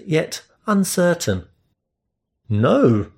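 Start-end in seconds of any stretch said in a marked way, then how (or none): 2.5-3.1 blurted no. Acted. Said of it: "I blurted out".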